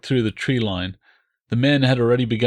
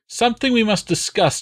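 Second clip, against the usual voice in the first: raised voice; 12 semitones higher than usual. A narrator's reading. Chief addressing general.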